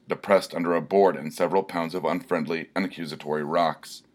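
ordinary delivery, nothing marked

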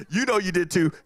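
usual speaking voice

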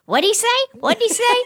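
shrill-pitched granny straining to hear; Myrtle, hard of hearing. Retold or imitated. Punchline setup, deaf Myrtle asking high and loud. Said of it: "And Myrtle goes".

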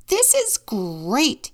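high, drawn-out delighted cry; overjoyed. A narrator's reading. Vet delighted to see a leopard, overjoyed. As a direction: stretch it out with loud delight. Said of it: "The veterinarian was overjoyed".

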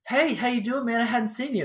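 high-pitched imitation of a friendly call; calling across bar. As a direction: hail someone across the room, without really yelling. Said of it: "the bartender yelled at me from across the bar".

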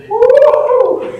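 high joyful whoop; excited whoop. Real, not acted. Preacher celebrating healing, joyful whoop. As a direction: let out a high celebratory woo.

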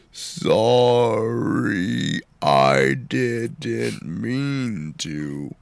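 low, slow creepy character voice; slow ominous raspy drawl. Acted. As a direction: drag every word out, menacing and low. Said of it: "I'm Hershey Squirt Flash".

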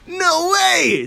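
high, loud burst of disbelief; amazed disbelief. Retold or imitated. Patient hears the dentist's nephew is an actor, amazed. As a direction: exclaim it as if starstruck.

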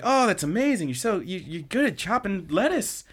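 put-on high, gushing girlish praise; bright-eyed admiring coo. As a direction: gush over him like he's a wonder. Anzu gushing over Nita.

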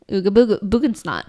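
fast, garbled Sims babble; mock Sims chatter; Sims gibberish. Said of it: "they're like".

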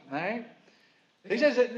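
usual speaking voice

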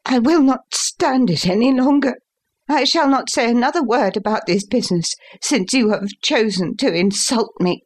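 high-pitched, wounded defiance; indignant son. A narrator's reading. Son vowing to leave his father's house, indignant.

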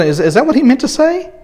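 half-panicked, checking what he just heard; a touch of nervous disbelief; anxious disciples. Pretend. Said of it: "if the disciples were".

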